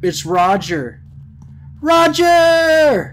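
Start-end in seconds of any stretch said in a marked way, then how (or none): 1.8-3.1 high, loud drawn-out shout; calling out. Real, not acted. Player hailing Roger the sheep, loud drawn-out call. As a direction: holler the name long and high.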